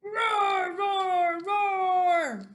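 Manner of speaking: loud, high, drawn-out roars; three big storybook battle roars; roaring dinosaur. Acted. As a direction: roar at full voice like a dinosaur. Dinosaur attacks the slide.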